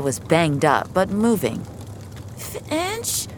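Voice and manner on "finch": faint, high, weak call; injured girl calling weakly. Acted. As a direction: barely get her name out, hurt. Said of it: "she said weakly".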